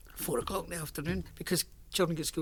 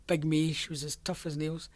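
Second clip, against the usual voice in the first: ordinary talk, nothing marked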